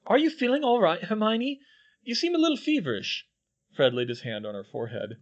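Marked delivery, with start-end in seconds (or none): 0.1-3.3 high, fast, teasing concern; high mock-worried teasing. A narrator's reading. A twin teases Hermione, high and mock-worried. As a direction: tease her as if she is ill.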